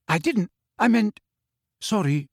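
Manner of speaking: high, halting backtrack that drops to a sorry; flustered, broken-off apology; hushed apology. A narrator's reading. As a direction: stammer out an apology, sinking at the end. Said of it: "he finished in a hushed voice".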